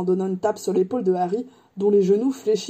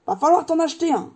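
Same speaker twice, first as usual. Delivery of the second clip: raised, lightly voiced character; animated giant Hagrid; 8 semitones higher, faster than usual. Hagrid shopping with Harry, lightly animated voice. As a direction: advise a youngster with some gusto.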